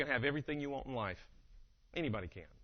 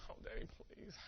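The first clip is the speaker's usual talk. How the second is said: put-on faint, whispery sleepy child plea; mock a child too sleepy to talk; sleepy daughter; 16 dB quieter than usual. Sleeping daughter to dad waking her, faint whine.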